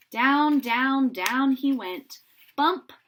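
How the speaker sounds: slow, loud falling down down down; dramatic descent. A narrator's reading. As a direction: let each down land heavily. Balloon descending.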